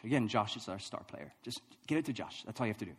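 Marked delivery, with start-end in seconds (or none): 1.4-2.9 imitating a serious coach, a little lower and softer; low-key, earnest coaching; really serious. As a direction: make the order simple and sober. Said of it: "he's really serious".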